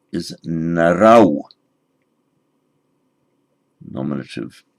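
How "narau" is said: slow, careful narau; Sanskrit dual form. Shown as a demonstration. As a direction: spell out narau slowly. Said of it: "the dual".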